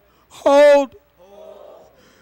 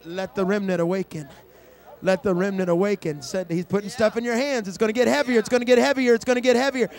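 soft prophetic repetition; 4 semitones lower, 4 dB quieter, faster than usual. Real, not acted. Low, soft repeated prophetic murmur.

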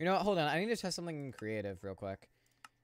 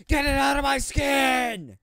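shrieked high and loud, slow; screaming meme voice; 14 semitones higher, 15 dB louder, slower than usual. Pretend. Streamer screams a viewer's meme line at chat. Reading chat donation aloud.

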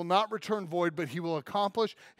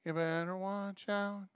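nasal voice; 7 dB quieter than usual. Retold or imitated. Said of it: "in her weird little back of the throat nasal voice".

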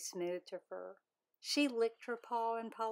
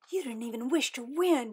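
hiss; 4 semitones higher, 6 dB louder than usual. Acted. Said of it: "she hissed at Lavender".

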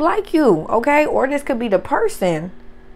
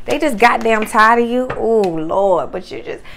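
normal talking voice

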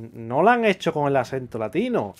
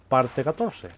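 normal talking voice

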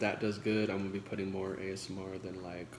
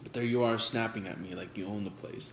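ordinary delivery

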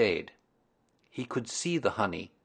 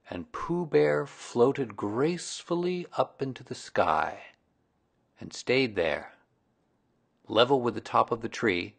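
usual speaking voice

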